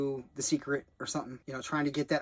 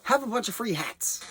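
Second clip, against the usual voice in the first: cheery company voice; 8 semitones higher, 5 dB louder than usual. Retold or imitated. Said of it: "They're like".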